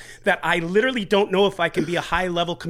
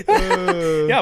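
laughing; 4 semitones higher, 6 dB louder than usual. Real, not acted. Group laughter.

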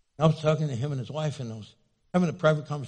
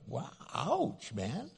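much softer wounded "Wow, ouch"; soft mock wince; hurt reaction; 11 dB quieter, much slower than usual. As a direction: murmur it like a stung listener. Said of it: "in a way that was like".

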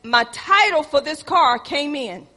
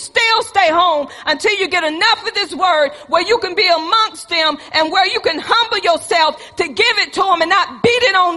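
rising, louder preacher's rebuke; preaching at full force; 4 semitones higher, 4 dB louder than usual. Acted. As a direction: drive it home like a pulpit warning.

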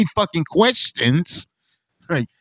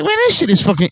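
way higher, breathless amazement; awed squeal; 12 semitones higher, faster than usual. Retold or imitated. Describing walking into the Denver airport. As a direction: squeak it out in mock awe.

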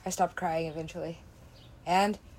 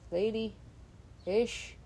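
ordinary delivery

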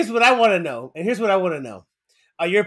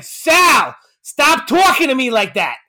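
loud, higher mock dad reprimand, drawn out; yelling dad; 4 semitones higher, 4 dB louder, much slower than usual. Imagined dad scolding son at dinner, mock shouting. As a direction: shout it like a fed-up dad, stretching the words. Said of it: "dinner conversation between Sal and his dad".

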